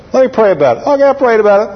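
comic eager turnaround, pitch jumping up; playfully hasty yes; mock instant decision. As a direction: pretend to pray, then agree at once. Jokingly instant prayer, then yes.

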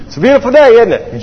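high, loud, chipper small talk; cheery small talk. Retold or imitated. Fuel driver chats to grumpy pilot, mock-cheery.

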